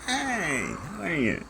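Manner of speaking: very high mock-friendly hello after a reset; fake sweet greeting. Resetting himself with a falsetto mock-polite hello. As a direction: start over with a sugary put-on greeting.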